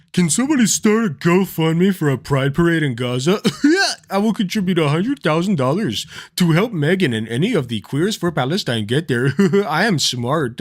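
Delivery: hammed-up mocking impression in a put-on voice; mock him with exaggerated, singsong emphasis; Tim Pool. Streamer showing the stupid thing he said, mocking caricature.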